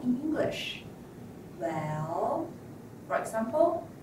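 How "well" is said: "Well" dipping low, then rising; falling-rising tone. Shown as a demonstration. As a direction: scoop "Well" down and up. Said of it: "a falling and rising tone".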